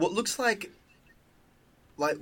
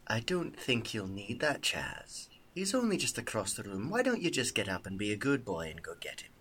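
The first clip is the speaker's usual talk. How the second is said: menacing quiet; 4 semitones lower, 8 dB quieter than usual. Acted. Dusk threatens Chaz at knifepoint.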